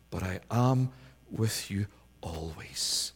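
slow, soft whisper; whispered assurance. Acted. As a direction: breathe the promise quietly. Voicing Jesus's quiet assurance.

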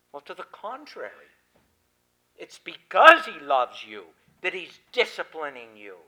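ordinary delivery

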